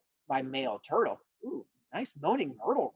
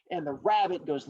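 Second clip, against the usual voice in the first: plain reading voice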